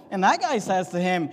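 ordinary talk

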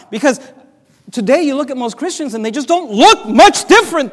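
high, loud, rising preaching climax; preaching at full force. Acted. Preacher crying out to the congregation, high and loud. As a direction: build to a fervent peak.

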